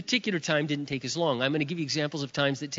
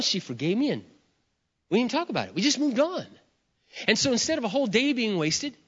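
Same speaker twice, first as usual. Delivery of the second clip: usual speaking voice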